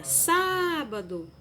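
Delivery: Sábado called out slowly, high and loud on SÁ; drawn-out call, SÁ-ba-do, stressing the first syllable; calling the word. Shown as a demonstration. Teacher calls out Sábado to show children the stressed syllable, slow and high. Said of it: "é só você chamar a palavrinha".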